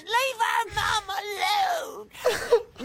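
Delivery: put-on high, slow, wailing child voice; children's voice. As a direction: squeal it like a small crying kid. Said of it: "reaching little baby midget hands out going".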